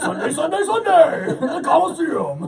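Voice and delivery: high, loud monster-truck ad hype; WWE promo voice. Pretend. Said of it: "W W E promo voice".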